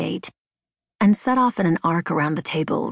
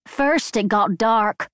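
higher, drawn-out, lightly Irish lilt; mischievous Irish accent; 4 semitones higher, slower than usual. A narrator's reading. Mother telling a joke's punchline, mischievous Irish accent. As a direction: stretch out the joke with a touch of Irish. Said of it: "mischievous with an Irish accent".

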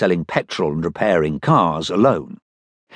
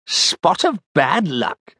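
slow, indignant echo of his words; a posh boss, irritated at bad news; angry captain; 9 semitones higher, much slower than usual. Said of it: "said the captain angrily".

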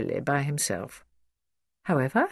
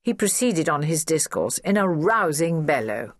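ordinary delivery, nothing marked

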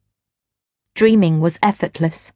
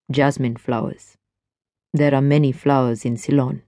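lower, easy man's voice; smooth stranger; 6 semitones lower, 3 dB quieter than usual. A narrator's reading. Stranger chats to Gwen at the rail, low and easy. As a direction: speak low and relaxed, at ease.

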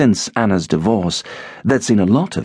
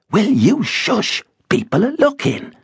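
hushing wife; 14 semitones higher than usual. A narrator's reading. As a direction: hush him up in embarrassment. Rosa hushing Ben.

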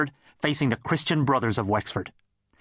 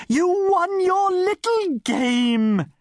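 much higher, louder, very slow theatrical gush; 4 dB louder, much slower than usual. Acted. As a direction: gush like a stage actress. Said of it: "gushed his mother".

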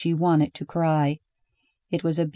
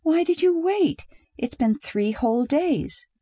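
upset wife; 10 semitones higher than usual. A narrator's reading. Wife reproaching absent husband.

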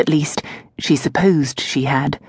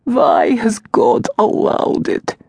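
higher, slow, tearful servant voice; grieving old servant; 5 semitones higher, slower than usual. Acted. Servant Varka weeping to her mistress, higher and slow. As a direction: ask heaven why, slowly.

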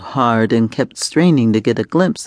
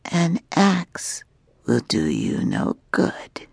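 slow, hushed, menacing whisper of a dragon queen; taunting dragon woman; 5 dB quieter, slower than usual. Acted. Dragon woman taunting her chained prisoner, slow hushed menace. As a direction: let the threat creep out in a breathy hush.

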